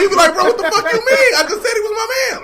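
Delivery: high-pitched, indignant comeback; mock defensive protest. Friend questioned about his man, mock defensive.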